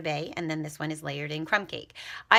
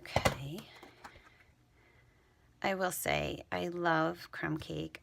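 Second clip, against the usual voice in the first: usual speaking voice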